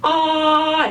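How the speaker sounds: 아 sung out high from the back of the throat; 아 said high and open, a touch nasal; Chinese a. Shown as a demonstration. Teacher demonstrating the Chinese a to students, high and open. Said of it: "그냥 아가 아니라".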